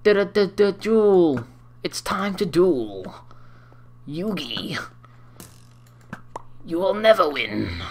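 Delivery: slow, drawn-out dramatic challenge; hammy duelist. Acted. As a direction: declare it like an over-the-top cartoon duelist. Mimics Yu-Gi-Oh duel catchphrase.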